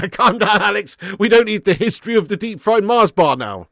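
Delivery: high, loud protest through laughter; laughing protest. Real, not acted. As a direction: laugh while telling a chatter to calm down. Laughing while teasing a chatter.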